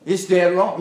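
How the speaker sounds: normal talking voice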